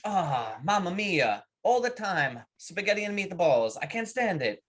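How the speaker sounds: exasperated Italian worker. Acted. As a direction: complain like a fed-up joke Italian. Italian worker complains in joke.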